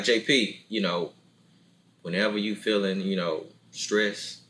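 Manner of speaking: a hint of a deep-voiced woman comforting; deep-voiced fiance. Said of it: "she ain't gonna say it with a deep voice".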